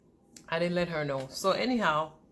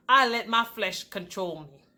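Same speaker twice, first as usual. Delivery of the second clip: ordinary talk, nothing marked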